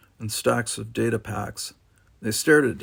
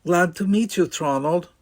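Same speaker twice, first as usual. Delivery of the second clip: higher, bright polite greeting; 7 semitones higher, 4 dB louder than usual. Acted. Deanna greeting, flustered.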